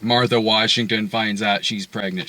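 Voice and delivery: ordinary delivery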